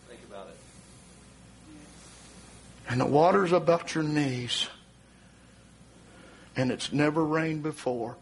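low, measured warning; solemn preaching. Real, not acted. Preacher warns congregation of the coming flood, solemn and low. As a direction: bring it down low and grave, with long pauses.

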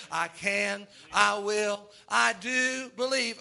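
slow, deliberate creed; slow firm declaration. Retold or imitated. Believer answering the devil, slow and firm. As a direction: plant each phrase like a stake.

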